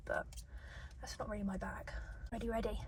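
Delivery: hushed breathy whisper. Real, not acted. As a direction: drop to a whisper. Whispering close to mic.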